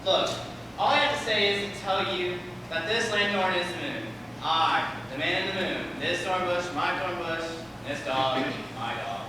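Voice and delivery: gruff mumbling actor losing patience; exasperated moonshine. Acted. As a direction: grumble it out fed up. Moon actor fed up.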